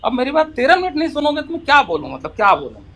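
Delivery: high, aggrieved protest; real frustration at being cut off; exasperated complaint. Real, not acted. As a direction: throw up the pitch in frustration. Complains nobody lets him speak.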